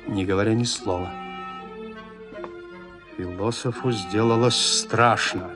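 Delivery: usual speaking voice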